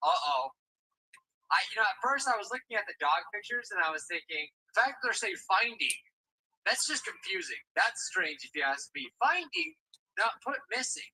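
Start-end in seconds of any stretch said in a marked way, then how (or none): none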